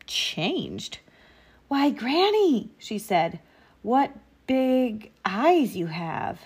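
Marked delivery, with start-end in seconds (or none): 1.7-2.7 put-on high, slow girlish surprise; drawn-out innocent amazement; wondering little girl. A narrator's reading. Girl astonished at changed Granny. 3.8-6.4 put-on slow, higher girlish wonder; puzzled child marvelling; wondering little girl. A narrator's reading. Girl astonished at changed Granny.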